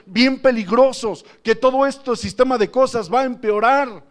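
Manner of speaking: loud, high-pitched impassioned sermon; full-force preaching. Real, not acted. Preacher warns brothers that God is not mocked, impassioned loud preaching. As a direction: exhort the congregation at full voice.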